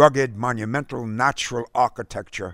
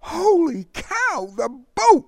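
put-on high boyish cry, building louder; called in alarm; 5 dB louder, slower than usual. A narrator's reading. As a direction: draw out the first words, then cry out in alarm. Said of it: "Derek called in alarm".

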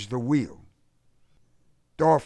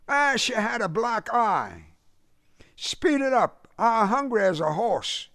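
brash high-pitched Southern brother; dismissive and impatient in a high Southern accent; 12 semitones higher than usual. A narrator's reading. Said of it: "His southern accent convulsed them".

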